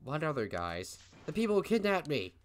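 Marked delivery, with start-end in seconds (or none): 1.2-2.3 put-on higher, louder frightened girl; girl voice, alarmed. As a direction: play the kidnapped girl. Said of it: "I'll go with female voice".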